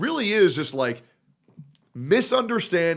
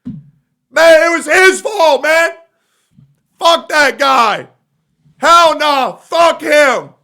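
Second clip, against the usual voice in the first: much higher, much louder, slow ranting imitation; mock rant; 10 semitones higher, 11 dB louder, much slower than usual. Mocking the rival cursing Saint Tontas, loud slow rant. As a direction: yell it like a petty blowup over a stubbed toe. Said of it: "you'll stub your toe and you'll say".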